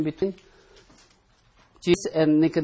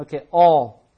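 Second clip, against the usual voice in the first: all said louder, falling firmly; stress on all; 9 dB louder than usual. Shown as a demonstration. Teacher to Bible class, stressing all. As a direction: hit the word all hard. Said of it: "Emphasis on all".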